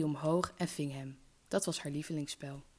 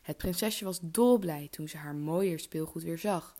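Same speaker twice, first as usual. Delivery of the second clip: usual speaking voice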